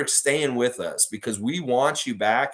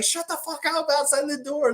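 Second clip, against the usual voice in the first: retold yelling. Streamer to noisy super outside, retold yell. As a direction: imitate yelling through a door, held back. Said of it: "I was yelling at him".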